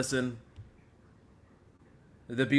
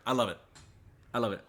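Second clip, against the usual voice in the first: ordinary delivery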